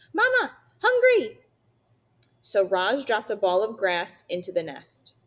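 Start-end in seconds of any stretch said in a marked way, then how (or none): 0.1-1.4 put-on very high, loud baby bird voice; peeping gosling. A narrator's reading. Gosling calls to its mother for food, high peeping. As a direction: squeal it like a hungry chick. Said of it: "Bright Bill started peeping".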